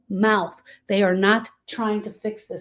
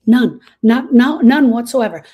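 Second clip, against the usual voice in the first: loud insistence; 9 dB louder than usual. Real, not acted. Emphatic denial.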